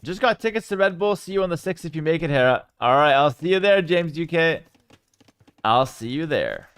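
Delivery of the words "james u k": ordinary delivery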